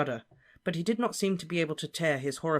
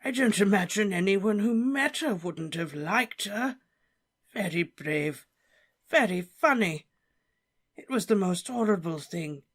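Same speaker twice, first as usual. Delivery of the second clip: put-on higher, emotional old professor; tearful Slughorn; 6 semitones higher than usual. Slughorn tearfully remembering Lily for Harry. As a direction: remember her fondly, near tears.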